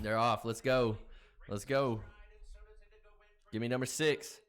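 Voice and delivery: loud, eager race cheering; root hard for your horse; excited cheering. Real, not acted.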